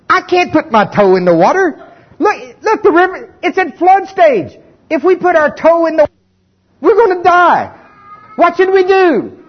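hammed-up terror of the river; mock panic. Israelites refusing to step into the flooded Jordan, high and frantic.

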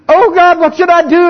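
panicked plea. Acted. A believer facing change crying out to God, high and loud. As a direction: cry out to God in mock panic.

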